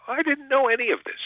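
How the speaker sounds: high-pitched, amazed disbelief; retold astonishment. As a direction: let the pitch leap in wonder. Retold astonishment at fans' stories.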